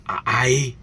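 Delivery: normal talking voice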